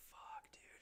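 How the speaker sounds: whispered dismay; hushed dread. Retold or imitated. Friends realizing this is not good, hushed dread. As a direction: mutter it under your breath in a whisper.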